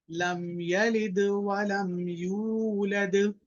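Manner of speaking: slow, melodic tajweed recitation with a long held final vowel; measured chanting recitation, the last word drawn out; Quranic recitation. Shown as a demonstration. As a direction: recite it slowly for the class to echo. Full surah recitation.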